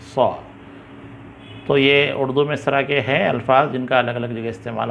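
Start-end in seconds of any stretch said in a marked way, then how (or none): 0.0-0.3 heavy s with rounded vowel; thick 'saw' for swad. Shown as a demonstration. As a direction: say 'saw', contrasting it with 'sa'. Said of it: "ज़रा मोटी आवाज़ आती है". (मोटी आवाज़ वाला 'सौ')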